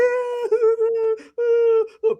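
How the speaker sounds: high-pitched put-on wail; mock child crying. Preacher imitating kindergarten kids in a film, high mock crying. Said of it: "when the kids were crying".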